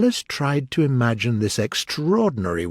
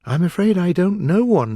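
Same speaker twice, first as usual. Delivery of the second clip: higher, louder anxious admission; 9 semitones higher, 5 dB louder than usual. A narrator's reading. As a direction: own up a little worriedly. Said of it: "said Alice anxiously".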